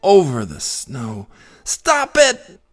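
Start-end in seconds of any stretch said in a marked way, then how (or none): 1.6-2.6 high, loud cat yell. A narrator's reading. Henry yells at the bouncing balloon. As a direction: shout it up at the balloon. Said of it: "Henry yelled at the balloon".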